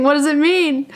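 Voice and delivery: high-pitched quick mock wonder; puzzled question in a high playful voice. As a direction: ask it high, at a normal clip. Mock pondering of an itchy nostril.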